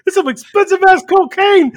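a hint of a furious buddy; amused retold yell; mock angry friend. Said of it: "He goes".

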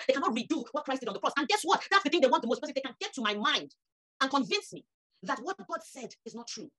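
high, ringing preacher's build to a peak; preaching at full force. Acted. Preacher rallying the church about what they cannot take, full force. Preaching climax, rising intensity.

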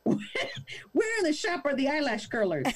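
mock Karen demand. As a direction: put on a different demanding voice.

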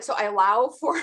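usual speaking voice

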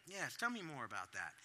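mild calm parent; calm listening. Shown as a demonstration. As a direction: keep it gentle and curious. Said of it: "what I'm doing on the inside".

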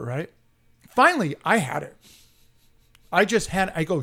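ordinary delivery

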